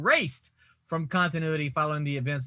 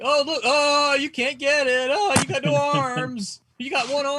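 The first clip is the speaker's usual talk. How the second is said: high, louder schoolyard teasing; mock taunting; 9 semitones higher, 4 dB louder than usual. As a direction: jeer at him like a bully. Mocking armless villain, keep-away.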